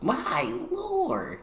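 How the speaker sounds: high, slow, stretched-out astonishment; reacting to a fifteen-hour flight, high astonished drawl; amazed exclamation. Real, not acted. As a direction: marvel at how far away it is.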